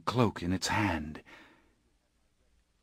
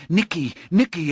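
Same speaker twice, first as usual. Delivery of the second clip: high, loud scream of a name; screaming for help; 9 semitones higher, 4 dB louder than usual. Acted. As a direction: cry out for a friend in terror. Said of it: "I screamed".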